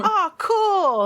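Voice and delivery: put-on high-pitched childlike delight; excited little fan; awestruck kid. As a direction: gush like an impressed kid. Game master acts awestruck kids.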